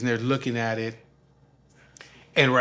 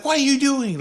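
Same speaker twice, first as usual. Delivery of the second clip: high, loud mimicked scolding; flipping out; 12 semitones higher, 8 dB louder than usual. Father scolding son as fly lands, flipping out. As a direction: imitate an outraged demand. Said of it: "he's flipping on me".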